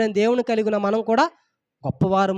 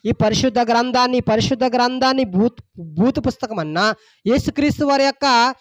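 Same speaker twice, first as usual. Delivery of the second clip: ordinary talk, nothing marked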